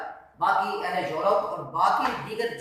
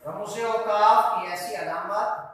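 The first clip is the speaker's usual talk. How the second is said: slow dictation; slower than usual. Real, not acted. Teacher dictating definition for writing.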